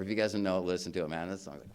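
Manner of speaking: ordinary delivery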